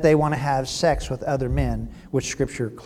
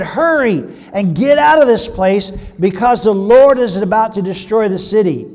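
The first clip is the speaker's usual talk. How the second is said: louder, higher, pressing warning; urgent warning; 6 semitones higher, 9 dB louder than usual. A narrator's reading. Lot to his sons-in-law, urgent warning. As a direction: urge them to get out at once.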